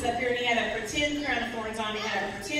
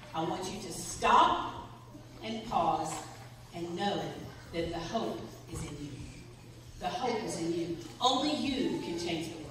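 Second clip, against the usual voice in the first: gentle, earnest; 6 semitones lower, 7 dB quieter than usual. Retold or imitated. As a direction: let each phrase settle quietly. Retells podcast lady's hopeful message.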